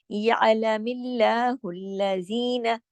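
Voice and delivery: या अलमिल्लाहुल्लजीना slow with thin ल; slow Quranic reading, thin ल; light lam recitation. Shown as a demonstration. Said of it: "इसको हमने मोटा नहीं किया".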